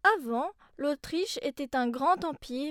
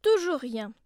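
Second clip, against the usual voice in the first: plain reading voice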